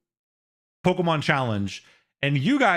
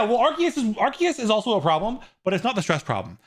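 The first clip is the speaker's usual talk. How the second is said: ordinary talk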